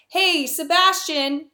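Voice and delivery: high, drawn-out hail; acted calling out. Antonio hailing the supposed Sebastian, high drawn-out call.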